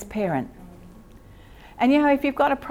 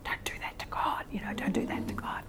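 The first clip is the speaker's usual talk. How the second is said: hushed, scandalised whisper; shocked religious whisper; 11 dB quieter than usual. Retold or imitated. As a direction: gasp it out like a pious scold. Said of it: "I would be so religious".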